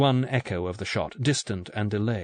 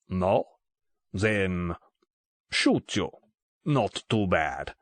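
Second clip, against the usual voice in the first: put-on lower, halting Russian-accented threat; thick Russian accent; 4 semitones lower, much slower than usual. A narrator's reading. Russian gunman threatens a motionless Bond, thick Russian accent. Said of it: "in a thick Russian accent".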